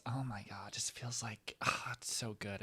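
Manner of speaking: hushed whisper of bliss. Real, not acted. Cozy in cabin with copper cup, whispered. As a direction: whisper as if savoring it.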